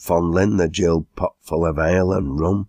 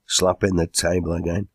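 ordinary delivery, nothing marked

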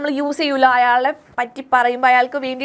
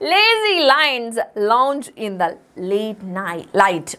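very slow, each L word marked; slow alliteration reading; 3 semitones lower, much slower than usual. Shown as a demonstration. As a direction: read it out for children. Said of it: "ല് sound വന്നു".

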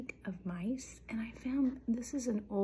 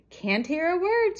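loud, high yell over traffic; story character's raised-pitch holler; screaming; 9 semitones higher, 12 dB louder than usual. A narrator's reading. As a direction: shout it up high as if drowned out. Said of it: "screamed Melissa".

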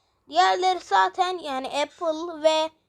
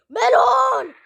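higher, louder anguished shout of "Melon"; crying out name; 8 semitones higher, 8 dB louder than usual. Acted. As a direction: call the fallen friend in alarm. Friend got hit, cries out.

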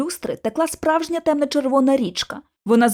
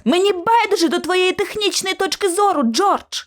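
higher-pitched irritated outburst; exclaiming; 8 semitones higher than usual. A narrator's reading. Narrator fed up with George's technical talk, exclaiming. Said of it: "вигукнула я".

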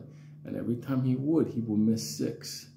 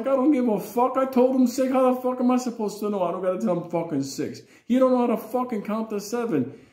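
high, loud furious rant; dad yelling back at the mom; angry father; 13 semitones higher, 6 dB louder than usual. Retold or imitated. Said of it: "My father's like".

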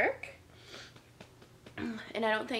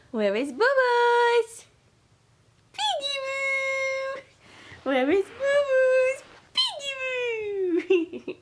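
very high, drawn-out sing-song; peekaboo baby talk; 6 dB louder, much slower than usual. Pretend. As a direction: stretch it slowly to make the baby laugh.

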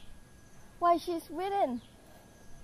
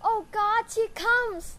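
high, excited outburst of relief; eager cry; 7 semitones higher than usual. Acted. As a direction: call out as if she finally arrived. Juliet sees the nurse arriving.

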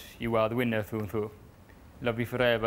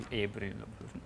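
much softer, as if aside; quiet correction; 10 dB quieter, much faster than usual. Real, not acted. Correcting the line while reading a letter, quiet. Rereads line to self, softly.

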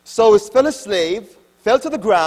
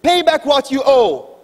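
louder, higher, a choking creditor's demand; harsh demand; 7 semitones higher, 7 dB louder than usual. Acted. Slave throttling the man who owes him, louder and higher. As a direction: squeeze the debt out of him, loud and high.

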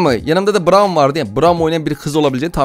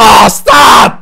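embarrassed shout; 4 semitones higher, 9 dB louder than usual. Real, not acted. Cringing at own old video.

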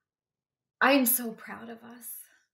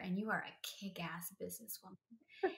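whispered praise; 14 dB quieter than usual. Real, not acted. Heartfelt tribute to cousin.

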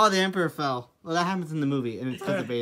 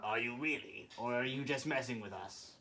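deep Emperor voice; 6 semitones lower, 19 dB quieter than usual. Acted.

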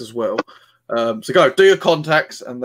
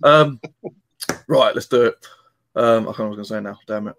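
ordinary talk, nothing marked

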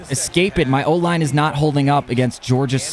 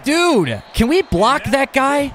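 exasperated plea; 11 semitones higher, slower than usual. Real, not acted. Gamer pleading with blockers.

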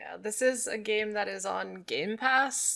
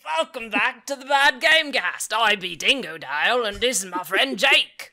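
loud mock Australian podcast host; hammed-up Australian accent; 3 semitones higher, 7 dB louder than usual. Shown as a demonstration. Cohost introduces the show in a louder put-on Australian accent. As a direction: play a brash Aussie host named Dingo Dale, loudly. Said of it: "I'd like to hear your best Australian accent".

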